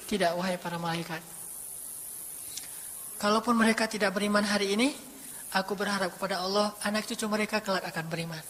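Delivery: the Prophet quietly refusing to punish the people of Taif; soft forgiving reply. Acted. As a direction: speak it low, gentle and without anger.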